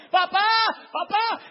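grito muy agudo y fuerte; llama desgarrado a papá; grito de niño. Acted. Isaac grita. (Isaac en el altar llama a su padre, gritando)

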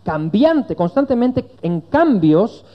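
high, loud, impassioned imitation of a sceptic; arguing friend. Preacher acts out his friend's case against Christianity, high insistent harangue. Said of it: "Me dijo".

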